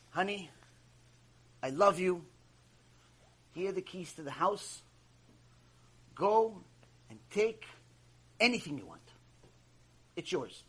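soft, low and halting, with long pauses; quiet loving farewell, acted out; tender husband. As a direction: gently hand it all over to a beloved wife. Husband tenderly parting from his wife.

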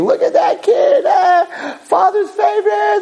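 very high, jeering cackle through the words; laughing mockery. Partying brothers jeering at the father's favorite, laughing mockery. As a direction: hoot with mocking laughter. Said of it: "they're laughing and drinking".

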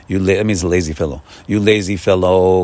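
ordinary talk, nothing marked

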